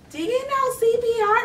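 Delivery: very high, loud put-on voice; exaggerated dispatcher squeal; phone operator. Operator questions twelve-year-old caller, comically high voice. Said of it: "And this is the operator".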